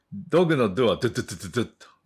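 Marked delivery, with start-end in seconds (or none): 1.0-1.7 "ドゥドゥドゥドゥドゥッ" in rapid, hard little bursts; short, forceful D. Shown as a demonstration. Teacher demonstrating the D in dog, short and forceful. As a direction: hit each "ドゥ" short and strong. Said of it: "短く、 強く".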